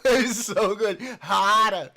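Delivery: laughing imitation of a loud moan; mock moan. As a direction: ham up the clip's moaning. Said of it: "imitating the clip".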